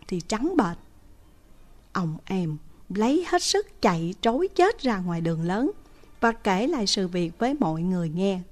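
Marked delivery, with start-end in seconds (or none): none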